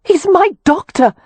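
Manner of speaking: high, urgent woman's cry. A narrator's reading. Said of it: "Wynne cried".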